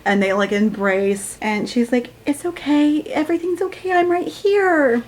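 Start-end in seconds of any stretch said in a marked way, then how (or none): 2.3-5.0 soothing character voice. Retold or imitated. Reading the girlfriend comforting Hayden, higher and soothing. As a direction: coo it like a tender comforter.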